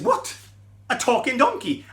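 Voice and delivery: high, startled cry of disbelief; shocked surprise. Acted. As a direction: jolt up as if a donkey just talked. Balaam startled by talking donkey.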